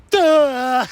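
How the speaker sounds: high, drawn-out dopey voice; mock dim-witted woman. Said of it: "And she's like".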